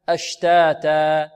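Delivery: أشتاتا slow, each alif held two beats; measured long vowels. Shown as a demonstration. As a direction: stretch أشتاتا evenly. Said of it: "مثل ماذا". (أشتاتا بمد طبيعي مضبوط)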